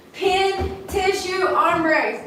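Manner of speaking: high singsong recital; singsong list. Real, not acted. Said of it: "repeats list to remember".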